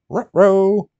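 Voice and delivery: dog-like Scooby-Doo uh-oh, with rolled r's on ruh-roh; the cartoon dog's ruh-roh. Streamer loses a piece, goes Scooby-Doo.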